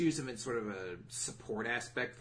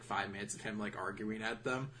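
ordinary talk, nothing marked

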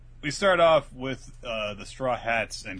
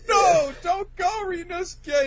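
very high, loud, slow wail; hammed-up grief-stricken cry; mock anguish; 6 dB louder than usual. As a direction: plead melodramatically with a fading friend. Acted mourning as dragon fades.

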